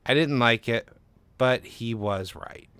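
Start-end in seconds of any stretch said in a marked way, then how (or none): none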